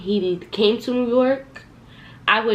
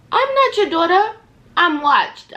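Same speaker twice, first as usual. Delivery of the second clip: loud, high-pitched incredulous outburst; indignant retort; 11 semitones higher, 7 dB louder than usual. Retold or imitated. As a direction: push back hard at a controlling boyfriend. Retort to boyfriend having her watched.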